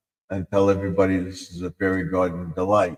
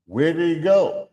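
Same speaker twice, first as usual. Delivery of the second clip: character voice; 8 semitones higher, 4 dB louder than usual. Acted. Skit voices about bridge.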